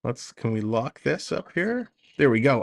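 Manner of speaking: ordinary delivery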